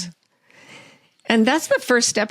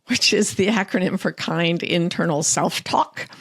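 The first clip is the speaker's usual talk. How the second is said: ordinary delivery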